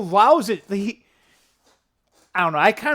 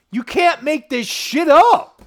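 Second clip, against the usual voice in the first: higher, louder, slow and deliberate; emphatic disbelief; 9 semitones higher, 8 dB louder, slower than usual. Real, not acted. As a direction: land each word with amazement. Date coincidence astonishment.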